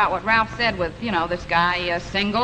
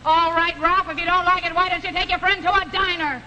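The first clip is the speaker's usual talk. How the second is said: higher-pitched, fed-up challenge; irritated wife, voice raised in pitch; annoyed retort; 4 semitones higher than usual. Acted. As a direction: fire back at a complaining husband. Wife snaps back in quarrel.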